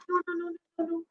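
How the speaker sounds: soft, tearful string of no's; a lightly acted cry, small and hurt; mock sobbing. Lamb tearfully telling friends never to trick her again, softly sobbing. Fluffy upset after feeling left out.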